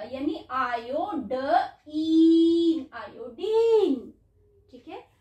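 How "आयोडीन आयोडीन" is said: आयोडीन slow, ending in a long held "deen"; British and Indian pronunciation. Shown as a demonstration. Teacher showing the British reading, आयोडीन with long ee. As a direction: stretch a double-e sound at the end of आयोडीन. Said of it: "तो इसमें double ई का sound आएगा".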